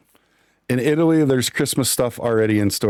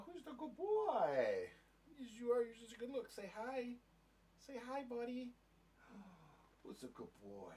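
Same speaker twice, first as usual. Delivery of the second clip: talking to a dog; 12 semitones higher, 27 dB quieter than usual. Real, not acted. Petting the dog Skippy.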